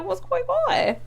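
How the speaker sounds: very high, squeaky-pitched disbelief; puzzled protest. Retold or imitated. Softening her complaint about job hunting, high puzzled protest. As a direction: ask it high up, without pushing the volume.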